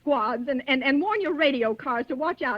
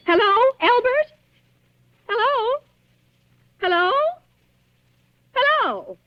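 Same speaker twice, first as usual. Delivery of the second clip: high, loud calls into the phone; anxious calling; 10 semitones higher, 4 dB louder than usual. Acted. Frightened wife answering a silent phone, calling high and loud. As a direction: call out, hoping someone answers.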